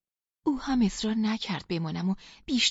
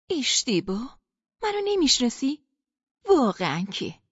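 hurt disbelief; 3 semitones higher, 3 dB louder than usual. Acted. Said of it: "گفتم".

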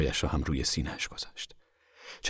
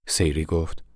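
usual speaking voice

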